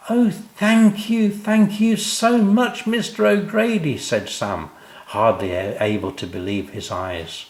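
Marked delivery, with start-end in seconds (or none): 0.1-4.0 put-on high, slow, thrilled child's thanks; overjoyed boy. Sam thanks O'Grady for the tree house, high and thrilled. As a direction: gush with delight like a small boy.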